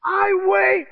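high, loud objection; alarmed protest. Retold or imitated. Player objects to being hauled up, alarmed. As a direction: blurt it in mock panic. Repeats his frightened objection.

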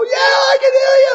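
very high, loud strained shriek; hammed-up yell. Patient answering while going under, hammed-up high yell. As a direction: yell it comically high to reach a far-off doctor. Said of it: "And I'm yelling".

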